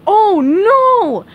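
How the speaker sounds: high, loud alarmed yell; panicked cry for a swimmer in trouble. Acted. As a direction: lifeguard shouting in alarm. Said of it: "yelled the lifeguard".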